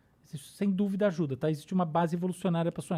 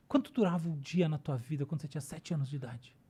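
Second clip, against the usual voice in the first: hushed, breathy rhetorical question; whisper; 4 semitones lower, 6 dB quieter than usual. Real, not acted. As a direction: drop to a whisper to draw them in.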